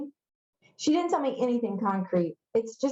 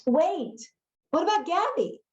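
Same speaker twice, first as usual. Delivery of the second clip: higher-pitched, pleading call to stop; urgent calling after; 8 semitones higher than usual. Acted. Charlie calls after Jackson as he leaves, urgently. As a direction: stop someone who is walking away.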